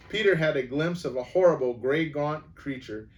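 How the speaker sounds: plain reading voice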